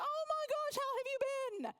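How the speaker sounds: mock gushing recognition. Guy pretends to know her.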